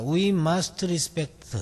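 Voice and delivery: very slow, each word drawn out; slow chunk reading. Shown as a demonstration. Teacher shows students where the sentence breaks, very slowly. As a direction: read just the first chunk, slowly and deliberately. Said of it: "의미를 한번 보면은".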